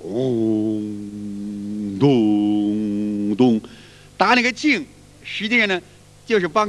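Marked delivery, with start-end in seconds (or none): 0.0-3.6 low, very slow, drawn-out dong like a struck chime stone; temple bell chime. Shown as a demonstration. Teacher imitating the temple chime for students, low and drawn out. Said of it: "到庙里边打那个磬".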